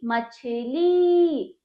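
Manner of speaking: मछली very slow, the final ली held long; drawn-out vowel. Shown as a demonstration. Teacher models the word for pupils, drawn out. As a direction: stretch the ई ending of मछली. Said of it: "खींच रही हूँ".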